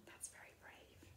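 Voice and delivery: soft, breathy aside; whispered aside. Real, not acted. Reader's whispered aside to child.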